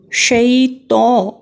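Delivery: شی تو with a full-mouthed heavy تو, very slow. Shown as a demonstration. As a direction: fill the mouth on تو. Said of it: "تو تو موٹا کر کے اس کو پڑھیں گے". (تو کو منہ بھر کے موٹا پڑھیں)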